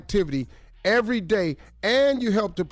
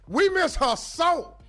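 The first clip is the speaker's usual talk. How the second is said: grand entrance announcement; 5 semitones higher than usual. Acted. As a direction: announce her like a star arriving. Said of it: "do that introduction at every venue".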